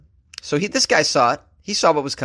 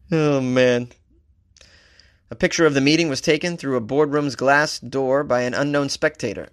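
ordinary delivery

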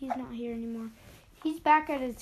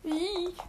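frightened squeal; 6 semitones higher than usual. Real, not acted. Enemy appears behind him.

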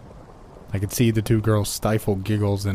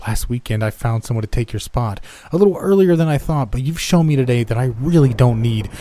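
angry boss; 3 semitones higher, 5 dB louder than usual. Acted. Boss firing him.

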